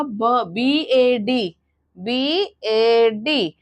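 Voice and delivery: बी ए डी spelled letter by letter, very slowly; slow letter spelling. Shown as a demonstration. Teacher mimicking a child spelling letters slowly. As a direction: drag out each letter as the wrong way. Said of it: "अगर आपका बच्चा ये बोलेगा".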